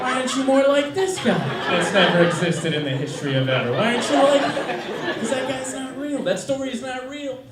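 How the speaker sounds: mock complaint to a boyfriend; lightly acted exasperated girlfriend; nagging girlfriend. Woman compares boyfriend to movie hero, mock nagging.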